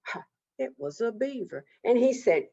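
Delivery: ordinary delivery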